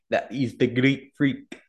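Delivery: put-on a slow, clumsy try at a Greek accent; half-hearted Greek accent on a nickname; attempted Greek accent. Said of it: "I can't do that accent".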